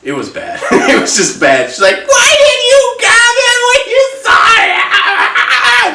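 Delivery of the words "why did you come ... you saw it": much higher, louder, slow mock accusing scream. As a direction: hurl the blame in a shriek. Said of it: "Just, like, screaming at me".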